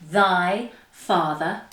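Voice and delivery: very slow, separated, swooping words; tongue-twister demo. Shown as a demonstration. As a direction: articulate each word by itself with big intonation. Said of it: "And again".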